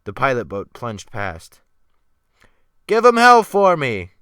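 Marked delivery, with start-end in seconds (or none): 2.9-4.1 loud, higher farewell shout; final cry. Acted. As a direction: call out a last cheer across the water. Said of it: "came a final cry".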